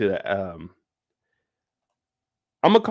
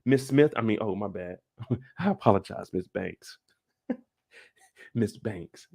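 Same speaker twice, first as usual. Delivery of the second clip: smooth talker; 2 semitones lower, 4 dB quieter than usual. Retold or imitated. Reed corrects her name to Miss Banks, smooth and hushed. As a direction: imitate a hushed charmer correcting himself. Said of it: "that's how he talks".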